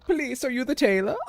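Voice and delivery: high-pitched, loud put-on shopkeeper voice; silly high tailor's greeting; tailor character voice. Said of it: "I don't know why I'm talking like that".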